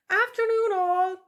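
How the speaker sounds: high, loud cheery call to the sows; bright fox greeting. A narrator's reading. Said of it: "she said as she neared the group".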